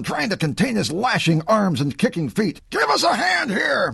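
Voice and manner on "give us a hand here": high, urgent call; shout for help. A narrator's reading. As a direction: call the players over. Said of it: "Marquardt shouted".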